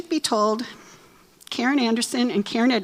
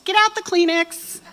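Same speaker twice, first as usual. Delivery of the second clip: mock tearful crowd; 8 semitones higher, 4 dB louder than usual. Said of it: "we're all just gonna hit".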